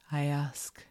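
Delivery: plain reading voice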